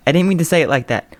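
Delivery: ordinary delivery, nothing marked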